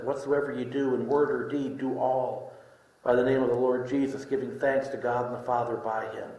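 usual speaking voice

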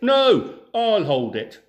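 high, loud snapping retort; squabbling. Acted. One thief argues with the other over the chicken, angry shout. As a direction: grab it back and shout him down.

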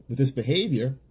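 ordinary talk

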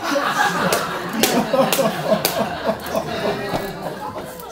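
long, loud burst of laughter. Real, not acted. Laughing out loud in the middle of her sentence. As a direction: break up laughing.

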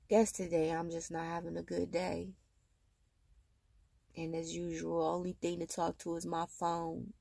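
barely there, worn out; tired mother venting to her phone, softly; quiet and low. Real, not acted. Low day, talking to phone.

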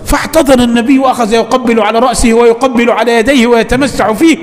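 ordinary delivery

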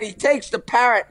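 ordinary talk

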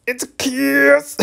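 slow, squeaky mock repeat of the line; high mocking echo. Player mocking the game character's line, squeaky. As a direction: parrot it back in a silly high voice.

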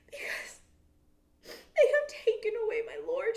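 high, loud tearful woman's voice; Mary crying. A narrator's reading. Mary cries to the angels, high and loud. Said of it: "Mary was standing outside of the tomb crying".

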